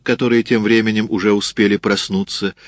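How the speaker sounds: ordinary delivery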